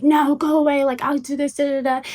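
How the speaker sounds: half-hearted imitation of a frantic friend snapping; retold yelling. Friend yelling at helpers while packing, mock yell. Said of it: "she's yelling at them".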